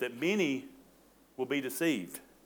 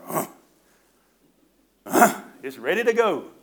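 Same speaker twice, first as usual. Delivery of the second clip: grunting lawnmower, higher and louder; eager lawnmower; 4 semitones higher, 8 dB louder than usual. Retold or imitated. Preacher voicing the lawnmower at home, raring to go. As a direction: give the mower a loud, revved-up voice. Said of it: "my lawnmower is at home like".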